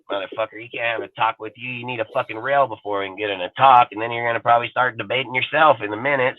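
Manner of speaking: ordinary delivery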